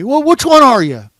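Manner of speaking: loud, high demand for an answer; imitated screaming. Gunman pressing the driver for an answer, screaming. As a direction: yell the question in the driver's face. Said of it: "he's screaming that at me".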